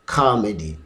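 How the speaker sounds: "Comedy" as KAH-medy, first syllable punched; "ka" pronunciation. Shown as a demonstration. Teacher showing British pronunciation, stressed open "kah". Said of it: "pronounced as the sound ka".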